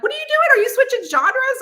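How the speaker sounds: mock alarmed high question; surprised authors. Fellow authors asking her in alarm, high and surprised. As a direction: play the shocked fellow author. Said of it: "And they're like".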